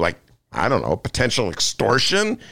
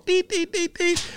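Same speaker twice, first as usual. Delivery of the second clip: much higher, chirpy electronic deet deet; phone alert beep; 4 dB quieter than usual. Shown as a demonstration. Host mimicking his phone alert mid-rant, high beeps. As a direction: imitate the phone's notification beep. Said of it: "update from my phone".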